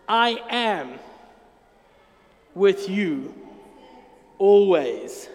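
slow, solemn I am with you, always; a solemn Jesus, heavier on always. Acted. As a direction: speak it as Christ's own promise. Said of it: "Matthew twenty-eight twenty promises us".

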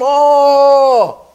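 high, loud cry of cold shock; boy shouting from the freezing river. Retold or imitated. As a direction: yell as the icy water hits. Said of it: "He screamed".

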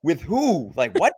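high, shocked question; a high, startled "with who"; surprised. Retold or imitated. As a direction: react in disbelief. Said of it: "And I go".

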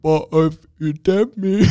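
high, slow, slurred mocking voice breaking into a laugh; a crude mocking impression, laughing. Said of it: "Helen Keller".

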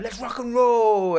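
high, slow swaggering greaser; drawn-out cocky rocker line; greaser tough guy. Retold or imitated. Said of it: "he's like".

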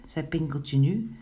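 plain reading voice

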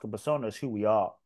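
ordinary talk, nothing marked

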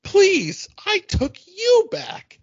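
high, slow, huffy comeback; indignant retort. Pretend. Playing the scorned partner, a high indignant retort. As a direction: fling it back high and offended. Mock couple quarrel retort.

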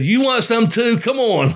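egging him on playfully; taunting the other wrestler in fun; mock challenge. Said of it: "like that".

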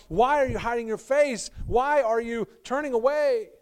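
high, loud anguished plea; crying out. Acted. Psalmist crying out to a hidden God, loud lament. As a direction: hurl the question at God. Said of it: "The psalmist who cries out".

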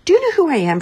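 a mother bird greeting her chick; playful mother bird. Acted.

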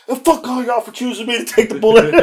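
playful aggrieved outburst; mock grievance. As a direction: imitate a sore passenger's parting shot. Acts out passenger's parting protest.

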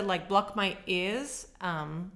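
usual speaking voice

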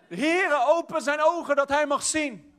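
earnest prophet's prayer; fervent prayer. Acted. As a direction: pray it out with rising fervour.

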